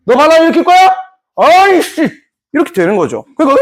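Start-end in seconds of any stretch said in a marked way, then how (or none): 0.1-2.1 mock threat. Acted threat over another revolt.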